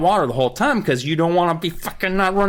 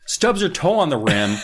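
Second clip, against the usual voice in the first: ordinary delivery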